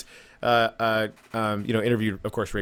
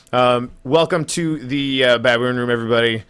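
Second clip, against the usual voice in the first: show host intro; 6 dB louder than usual. Acted. Host formally opens show.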